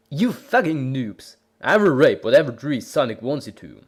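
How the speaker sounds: ordinary delivery, nothing marked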